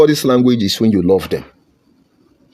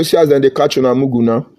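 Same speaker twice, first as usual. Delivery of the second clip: normal talking voice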